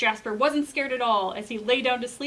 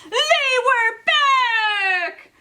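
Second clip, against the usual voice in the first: much higher, louder, slow wail; horrified cry; 13 semitones higher, 10 dB louder, much slower than usual. A narrator's reading. Narrating the scare to kids, a loud horrified wail. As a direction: wail it in horror. Shock reveal in story.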